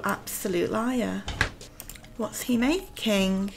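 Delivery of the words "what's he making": ordinary talk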